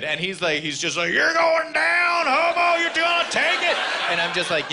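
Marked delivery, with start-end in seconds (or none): 1.1-3.8 high, screaming mock rage; hammed-up livid shouting. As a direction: stretch out a furious high yell. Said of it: "then he's just livid".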